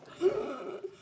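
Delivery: a high sudden intake of breath in shock; sharp shocked gasp. Retold or imitated. Waking to the baby convulsing, a high sharp gasp. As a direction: gasp as if waking to something terrible.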